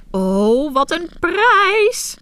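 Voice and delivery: put-on high, loud and drawn-out old woman's delight; excited cry. A narrator's reading. Old woman spotting the duckling, crying out in excitement. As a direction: crow over a prize find, slowly and loud. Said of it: "riep ze uit".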